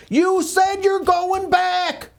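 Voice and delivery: high, shrill imitation of a panicked mother; mother screaming. Son imitating his mother on the phone, high frantic screaming. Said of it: "my mother crying and screaming".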